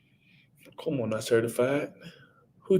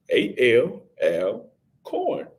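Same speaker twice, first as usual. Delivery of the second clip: spelling out slowly; much slower than usual. Shown as a demonstration. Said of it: "Now let's put it to Alcorn".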